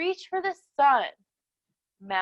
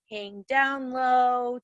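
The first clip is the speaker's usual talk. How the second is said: normal talking voice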